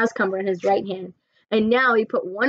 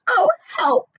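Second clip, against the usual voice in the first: mock cry; 5 dB louder than usual. Sophie disgusted.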